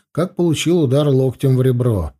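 plain reading voice

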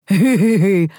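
put-on he-he-he; fake chuckle. A narrator's reading. Second brother joining the pretend laughter, fake chuckle. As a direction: chime in with a forced giggle. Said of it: "подхватил Лиам".

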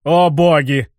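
mock-dramatic cry of dismay; theatrical exclamation. A narrator's reading. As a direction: ham it up like a stage actor. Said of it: "картинно воскликнул я".

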